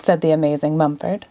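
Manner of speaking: ordinary delivery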